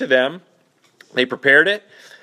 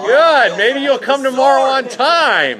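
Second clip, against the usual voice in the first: higher and louder playful jab; teasing; 10 semitones higher, 6 dB louder than usual. Real, not acted. Teacher teasing a late student, loud and playful. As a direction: rib the latecomer loudly.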